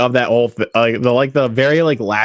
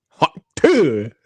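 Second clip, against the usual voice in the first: voice bit; 3 semitones higher than usual. Shown as a demonstration. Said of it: "why don't you do that".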